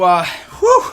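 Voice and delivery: winded puff of air; breathless whew. Real, not acted. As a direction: blow out a tired breath. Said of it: "Just give me a second here".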